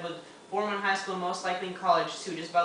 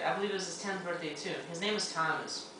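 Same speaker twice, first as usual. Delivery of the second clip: ordinary talk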